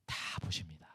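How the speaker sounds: hushed whisper. Acted. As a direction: drop to a breathy whisper for effect. Soft climax of sermon point. (속삭이듯 작게)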